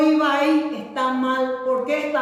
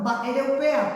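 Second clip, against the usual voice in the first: ordinary talk, nothing marked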